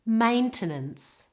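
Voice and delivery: slow "Maintenance" with the weight on MAIN; "Maintenance": full A first, then two weak vowels; stress on first syllable. Shown as a demonstration. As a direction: model "Maintenance" slowly, front-stressed. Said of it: "It's on the first part of the word".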